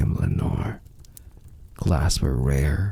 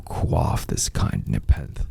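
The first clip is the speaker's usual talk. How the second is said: usual speaking voice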